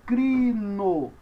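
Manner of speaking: "crino" in long, separated syllables; pronunciation demo. Shown as a demonstration. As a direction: stretch "crino" slowly for learners. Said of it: "você pronuncia cri".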